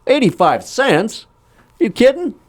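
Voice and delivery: high, slow, incredulous outrage; mock outrage. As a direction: act shocked at the price, pitch way up. Character outraged at price.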